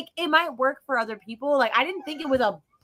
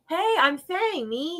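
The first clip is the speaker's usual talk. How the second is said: normal talking voice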